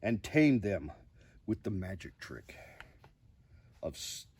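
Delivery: lively storybook reading with big rises; reading to children. Acted. Host reading a picture book to viewers, expressive storybook voice. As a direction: read the bedtime book aloud with drama.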